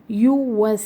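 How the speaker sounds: یو وس with lips rounded on each vowel. Shown as a demonstration. Teacher shows the lip rounding in یو وس. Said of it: "ہونٹوں کو گولنگ".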